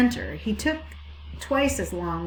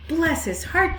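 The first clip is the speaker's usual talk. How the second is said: put-on high, fond old ewe voice; doting sheep, pitched up; fond sheep cry; 9 semitones higher, 4 dB louder, slower than usual. A narrator's reading. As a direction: coo over him like doting sheep. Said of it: "they cried".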